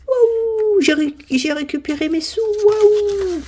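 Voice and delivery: very high delighted squeals; overjoyed high cries over the win; squeal of joy. Real, not acted. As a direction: squeal like you just hit the jackpot. Celebrating won money.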